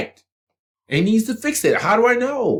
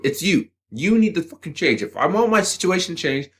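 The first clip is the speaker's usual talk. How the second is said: ordinary talk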